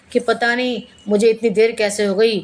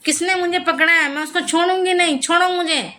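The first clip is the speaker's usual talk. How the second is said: much higher voice that scolds, with a mock shout at no more volume; aunt, still sinking, scolds the boy gripping her hair in a much higher voice; possessed scolding; 7 semitones higher than usual. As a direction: scold like a spirit that refuses to let go. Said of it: "चिल्ला रही थी, भैया को डांट रही थी".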